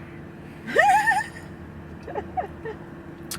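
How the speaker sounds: shrill laugh burst; high squealing laugh. Real, not acted. Laughs at beard story.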